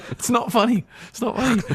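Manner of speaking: very high, squeaky through laughter; laughing protest. Real, not acted. Storyteller objects to friend's joke about him, high laughing protest. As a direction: protest while cracking up, pitch shooting up.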